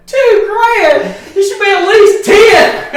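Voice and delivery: very high, loud squeal breaking into laughter; hammed-up indignant son; mock outrage. Son to dad over a small share: squealing, laughing.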